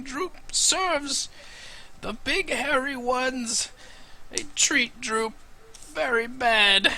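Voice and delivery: high, slow pleading goblin voice. Acted. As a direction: beg like a starving goblin.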